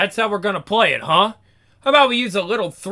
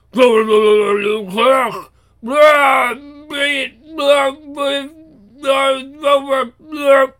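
gurgling fish man croak; 6 semitones higher, slower than usual. Acted. Creature gurgling scream.

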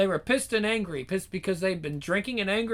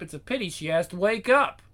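usual speaking voice

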